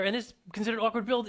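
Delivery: ordinary talk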